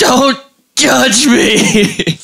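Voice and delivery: mock guilty plea. [name] imitated eating.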